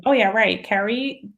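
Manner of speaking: ordinary delivery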